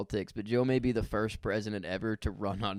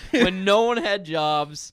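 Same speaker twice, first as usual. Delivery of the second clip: slow, loud disbelief; 8 semitones higher, 10 dB louder, slower than usual. Real, not acted. Incredulous emphasis.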